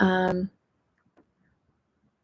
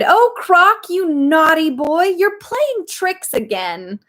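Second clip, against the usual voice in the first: much higher, louder scolding lady; 10 semitones higher, 4 dB louder than usual. A narrator's reading. Lady scolding her pet crocodile, high voice. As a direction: chide a naughty pet in a high voice. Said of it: "She cried".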